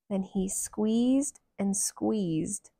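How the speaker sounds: ordinary delivery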